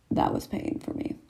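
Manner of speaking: low hushed wince; pained whisper. Real, not acted. Vlogger whispering after pulling an eyelash. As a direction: breathe it out after the sting.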